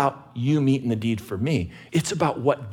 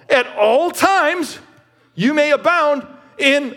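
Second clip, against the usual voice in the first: high and loud, slow, with "all" leaned on; emphasis on all; 13 semitones higher, 5 dB louder, slower than usual. Shown as a demonstration. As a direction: preach "at all times" slowly, driving on "all". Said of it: "emphasis on everything".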